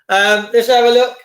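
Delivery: ordinary talk, nothing marked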